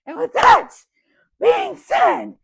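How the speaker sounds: loud high-pitched goodbye flourish; squeaky sign-off. Pretend. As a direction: squeal the outro like a cartoon. Sign-off before losing voice.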